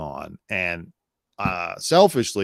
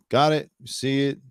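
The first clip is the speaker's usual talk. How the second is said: normal talking voice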